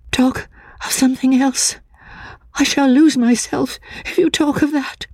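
high-pitched, anxious warning; agitated frightened woman. A narrator's reading. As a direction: plead to change the subject in a high, tense voice. Voices the distressed woman in the story.

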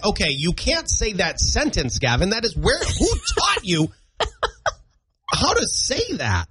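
high-pitched parental reproach, hammed up; mock scolding dad. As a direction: scold a child in disbelief. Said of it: "I have to be like".

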